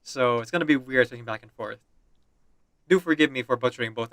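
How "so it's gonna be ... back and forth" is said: ordinary talk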